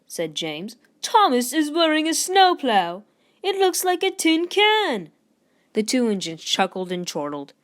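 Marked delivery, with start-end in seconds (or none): 1.0-5.1 high, loud teasing; much higher, louder jeering; mocking. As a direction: sneer at the snowplow. James mocks Thomas.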